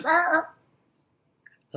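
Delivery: high loud squawks; high, loud parrot squawk. Acted. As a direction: squawk from inside the freezer. Parrot cursing from freezer.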